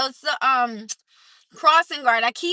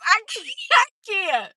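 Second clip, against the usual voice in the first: ordinary talk, nothing marked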